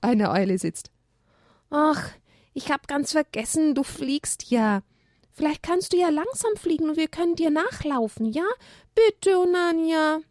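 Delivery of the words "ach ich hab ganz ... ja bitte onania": put-on high, childlike pleading voice; pleading little donkey. A narrator's reading. Haberle begs the owl to let them follow, pleading. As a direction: beg sweetly, drawing out the bitte. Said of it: "Haberles Flehen".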